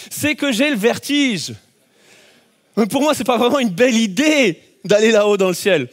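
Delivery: ordinary talk, nothing marked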